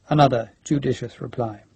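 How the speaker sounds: ordinary delivery, nothing marked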